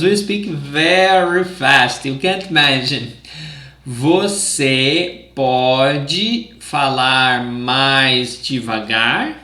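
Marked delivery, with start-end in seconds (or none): 3.9-9.4 drawn-out and deliberate; slow it way down for learners; slow, careful model. Shown as a demonstration. Teacher showing the phrase slowly so students can repeat. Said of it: "demonstrating slow speech".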